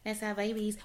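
a hint of dreamy infatuation; lovestruck gushing. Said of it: "I was like".

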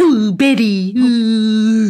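put-on character voice with drawn-out oohs; classic TV character impression. Showing friends another old impression, drawn-out catchphrase voice. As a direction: do a well-worn catchphrase in caricature. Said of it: "there was the other one".

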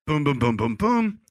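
punchy rising booms; imitating the group laughing, boom by boom; mock laughter beats.

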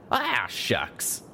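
aw-shucks cowboy voice; grinning modesty. A narrator's reading. Pegasus brushes off her caution, grinning. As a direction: say it through a grin. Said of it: "still grinning".

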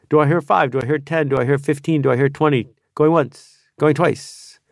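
quick rising auctioneer call. Acted. Auctioneer calling bids.

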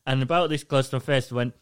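ordinary delivery

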